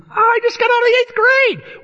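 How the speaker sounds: very high, strained protest; hammed-up overwhelmed outburst; mock panic. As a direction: squeal it like an overwhelmed eighth grader. Overwhelmed boy protests to counselor.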